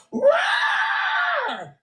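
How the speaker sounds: "Roar" as a huge high-pitched beast roar; mock roar. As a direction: roar like a cartoon monster to mock her. Mocks her strong manly voice.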